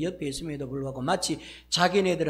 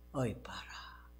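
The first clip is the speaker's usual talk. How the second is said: breathy, softer "어이 봐라" like a sigh; whispered lament; 5 semitones lower, 12 dB quieter, slower than usual. Acted. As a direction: let it escape as a despairing sigh. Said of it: "하나님의 입에서 튀어나온 어떤 탄식어". (탄식하듯 속삭이며)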